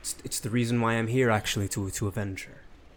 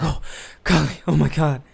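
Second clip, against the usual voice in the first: sudden delighted cry, louder; joyful relief; 5 semitones higher, 7 dB louder than usual. Acted. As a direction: burst out in relief at the waking pet. Companion wakes up.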